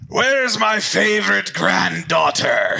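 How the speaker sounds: loud, high, drawn-out booming call; big booming voice. Acted. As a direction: boom it out through the whole house. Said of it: "a big booming voice".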